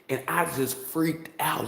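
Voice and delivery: usual speaking voice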